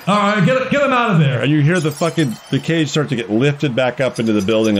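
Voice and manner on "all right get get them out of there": announcer voice, pitched up; announcer giving orders. Acted. Announcer orders the fighters pulled out, high and urgent. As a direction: order it hurriedly in a high showman's voice. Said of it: "he's saying".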